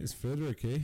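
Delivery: put-on light Canadian accent on Frederick, eh. Friends joking about a Canadian inventor, light Canadian accent. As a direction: tease with a Canadian twang. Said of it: "work on your Canadian accent".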